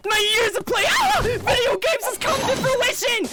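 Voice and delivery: high, loud, drawn-out victory cry; victorious gamer. Acted. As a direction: crow over the win, stretching it out.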